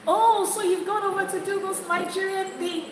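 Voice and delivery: high, sneering voice of a doubter; mock sneering skeptic. Acted skeptical person's question.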